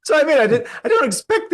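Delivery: real laughter carrying the words; laughing. Real, not acted. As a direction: talk while still cracking up. Laughing while recounting insult.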